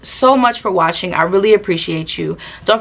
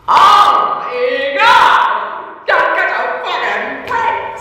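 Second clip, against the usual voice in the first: very high, drawn-out mock wail; shouted whine, slowly stretched out; exasperated wail; 10 semitones higher, much slower than usual. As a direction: cry out in hammed-up exasperation. Exasperated reaction to being called over.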